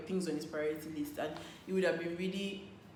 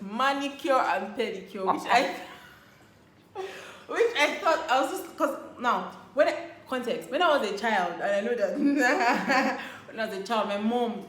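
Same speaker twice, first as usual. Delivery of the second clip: loud laughing words; 3 semitones higher, 8 dB louder than usual. Real, not acted. Telling of the manicure surprise, laughing loudly. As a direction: laugh through it, high and loud.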